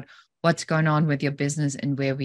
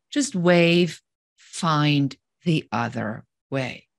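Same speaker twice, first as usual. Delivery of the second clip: slow, weighty advice; much slower than usual. Acted. Punchline of the advice.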